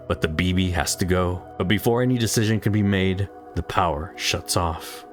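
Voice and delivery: ordinary delivery, nothing marked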